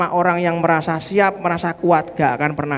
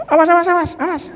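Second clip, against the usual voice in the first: mock alarmed yell; 12 semitones higher, much faster than usual. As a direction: yelp it like a scared boy. Kid warning during procedure.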